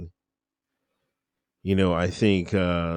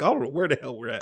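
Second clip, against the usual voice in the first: chuckling through the words; laughing quote; 3 semitones higher, 4 dB quieter, faster than usual. Retold or imitated. Laughing about being lost in Europe, chuckling. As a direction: laugh as you admit you're lost.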